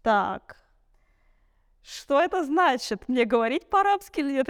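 mock bewilderment, pitch jumping up after a pause; acted puzzled reaction. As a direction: play a friend thrown into confusion. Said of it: "у них такой взгляд".

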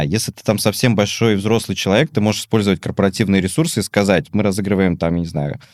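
usual speaking voice

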